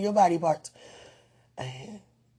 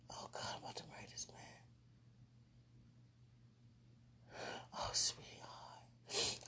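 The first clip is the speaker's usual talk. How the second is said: faint whisper; 18 dB quieter than usual. Real, not acted. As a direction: swoon it in a whisper. Whispered gushing.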